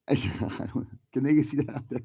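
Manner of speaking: usual speaking voice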